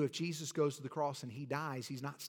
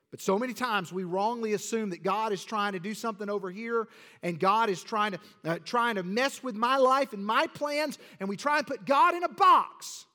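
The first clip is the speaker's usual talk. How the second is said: rising loud preaching build; full-force preaching; 4 semitones higher, 7 dB louder than usual. Real, not acted.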